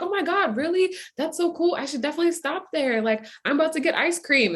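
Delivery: high-pitched imitation of an excited stranger; gushing stranger. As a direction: gush like a chatty woman in line. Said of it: "then she turns around, like".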